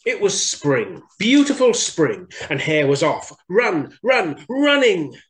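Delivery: put-on bright, bouncy read-aloud for kids; excited storyteller opening the tale; lively story narration. A narrator's reading. Reading aloud to little children, lively.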